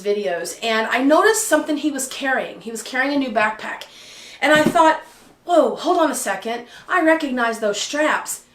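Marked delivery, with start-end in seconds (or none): none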